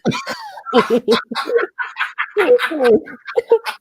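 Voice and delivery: loud, high laughter that goes on; loud laughing fit. Real, not acted. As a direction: laugh out loud, long and hard. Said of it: "This is too funny".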